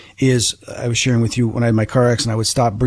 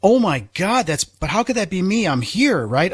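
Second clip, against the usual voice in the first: much higher, voice jumping up in shock; stunned disbelief; 7 semitones higher than usual. Retold or imitated. Staring down at her own body, high shocked disbelief. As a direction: gasp it out as if you can't believe what you see.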